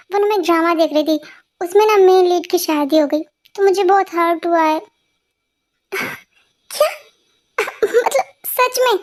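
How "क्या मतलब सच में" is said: a high breathy "What?", then a slow "really?"; can't believe the silly reason; stunned disbelief. Acted. Boss shocked at drama excuse. (हैरानी से, धीरे धीरे)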